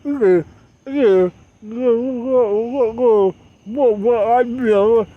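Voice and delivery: high, loud, slow grunting; mocking grunts. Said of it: "you act like".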